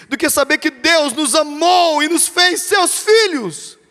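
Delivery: high, loud, slow triumphant preaching; soaring pulpit climax; climactic preaching. Acted. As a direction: proclaim it as the peak of the sermon. Climax of sermon.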